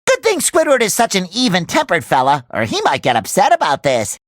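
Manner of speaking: usual speaking voice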